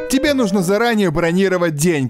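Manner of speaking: usual speaking voice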